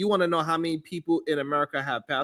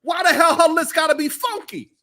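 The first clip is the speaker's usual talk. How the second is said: exasperated; 11 semitones higher, 4 dB louder than usual. Real, not acted. Moderator calls out, exasperated.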